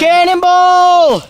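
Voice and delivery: long drawn-out high yell; cannonball yell. Real, not acted. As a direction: shout it as you jump in. Jumping into flooded water.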